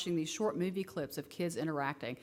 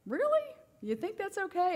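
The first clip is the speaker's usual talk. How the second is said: much higher, mock-shocked question; incredulous; 13 semitones higher than usual. Therapist questioning the kids' answer, much higher mock disbelief. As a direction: sound amazed they'd say yes.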